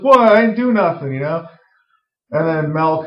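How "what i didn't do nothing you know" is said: higher, innocent protest; defensive Timmy. Retold or imitated. Timmy protesting to his family, mock innocent. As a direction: play it like a kid caught out. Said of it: "And Timmy's like".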